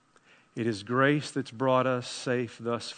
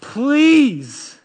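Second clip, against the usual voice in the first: louder, urgent pleading sermon; fervent preacher; impassioned preaching; 10 semitones higher, 10 dB louder than usual. Acted. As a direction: press the listener to believe. Preaches at full force.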